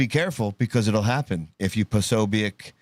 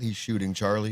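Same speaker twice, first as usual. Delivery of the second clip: normal talking voice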